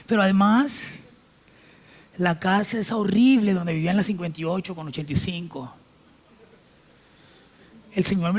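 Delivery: a show-off friend gloating, lightly voiced; boastful friend. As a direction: brag like a smug rich friend.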